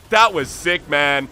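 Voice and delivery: excited praise. Real, not acted. Praising a clutch flash.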